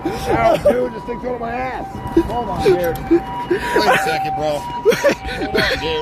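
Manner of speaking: high panicked shouts; panicked yelling. Real, not acted. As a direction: yell in a panic. Panicking by fire truck.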